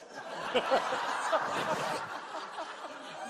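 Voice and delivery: breathy open laughter at the mix-up; real laugh. Real, not acted. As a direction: burst out laughing. Laughing at the hundred-dollar mix-up.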